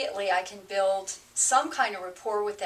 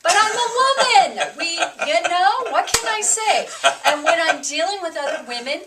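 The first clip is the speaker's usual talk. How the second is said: loud, high, laughing self-defense; playful protest; 8 semitones higher, 8 dB louder than usual. Real, not acted. Wife teasing her partner back, loud and laughing.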